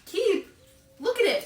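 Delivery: high, incredulous disbelief; can't believe the dog wants to keep it. Real, not acted. Arguing with her dog over a ratty toy, incredulous.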